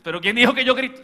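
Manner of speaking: loud, indignant yell; shouted denial. Retold or imitated. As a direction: yell that you never yell.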